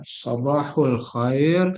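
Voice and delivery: "sabahul khair" slowly with a full "hul"; formal fusha pronunciation. Shown as a demonstration. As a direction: draw out "sabahul khair" with the u ending. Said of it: "dalam fusha itu biasanya pakai kata".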